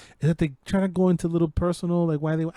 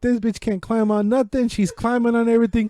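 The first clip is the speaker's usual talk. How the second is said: ordinary talk, nothing marked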